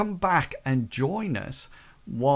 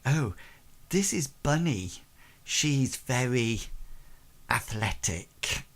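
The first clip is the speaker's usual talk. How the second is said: suggestive hostess; 4 dB quieter than usual. Acted. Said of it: "walks past and says".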